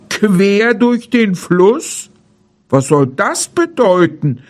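a hint of a baffled bear; bewildered bear. A narrator's reading. Bear questioning the deer about the dam, mildly baffled. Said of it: "rief der Bär".

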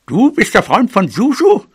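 high, swooping disbelief; put-on old mouse, astonished; incredulous granny. A narrator's reading. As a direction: question it as the offended granny. Grandmother disbelieves the stranger's claim.

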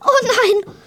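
high-pitched moan of dismay. A narrator's reading. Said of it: "stöhnte Emily".

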